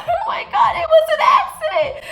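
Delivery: high laughing shout; laughing panic. Real, not acted. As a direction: squeal it through laughter in panic. Told to call dad.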